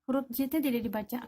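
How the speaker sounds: ordinary talk, nothing marked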